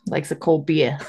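New England "bee-ah" for beer; New England accent. Shown as a demonstration. Cohost chiming in about a cold drink, New England accent. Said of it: "That's how we talk in New England".

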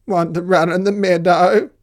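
high, loud bleating question; bleating sheep. Acted. Sheep inviting the spider to the meadow, bleating. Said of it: "bleated the sheep".

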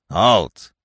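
loud, sudden order; commanding cry. A narrator's reading. Carus springing out at Bambi, commanding. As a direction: stop him with one loud word. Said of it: "he cried".

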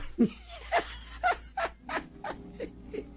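high, breathy wicked cackle; sneaky old man's wicked laugh; gleeful villain cackle. Acted. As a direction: cackle to yourself over the trick. Trickster gloats over his prank.

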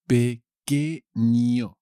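"Pequeño" very slow, as pe, que, ño; "Pequeño" in three slow beats: pe... que... ño; syllable by syllable. Shown as a demonstration. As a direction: draw out and separate each syllable of "Pequeño". Teacher breaks word into syllables.

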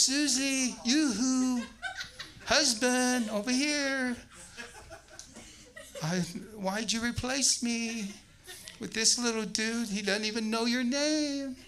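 high, loud, laughing plea for attention; needy husband calling. Retold or imitated. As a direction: call out to his wife like a jealous kid.

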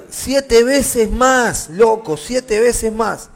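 loud scripture reading with stretched key words; forceful preaching. Acted. Preacher tells of the furnace heated sevenfold, emphatic. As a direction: proclaim it to the room, drawing out the emphasis.

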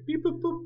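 high beep boop button tones; beep boop noise. Shown as a demonstration. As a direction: mimic dialing beeps. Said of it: "we could combine them".

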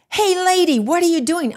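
imitated clerk, high and loud; indignant challenge. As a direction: call her out mid-step, mock outrage. Acts clerk challenging her in the closet.

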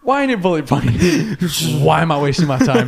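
exasperated question broken by laughter; giggling at the absurd books; laughing rant. Retold or imitated. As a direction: laugh through a mock complaint. Mock rant about exam books.

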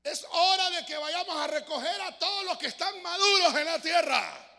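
grito agudo y lento del ángel; voz potente. Acted. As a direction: clama al ángel con toda la fuerza. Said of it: "con voz potente". (ángel gritando con voz potente)

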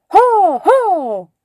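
loud, high call of "ho"; shout. A narrator's reading. Master shouts at the cranes to scare them, loud and high. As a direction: shout it out to startle the birds. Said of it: "Se acercó y gritó".

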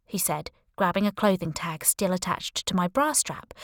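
plain reading voice

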